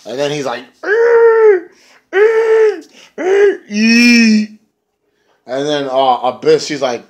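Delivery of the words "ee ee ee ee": very high, loud, piercing eee cries; shrill mock shriek. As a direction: imitate a crazed screech. Crazy Steve's shrieks.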